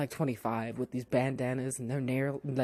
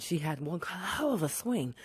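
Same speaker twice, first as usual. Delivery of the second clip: normal talking voice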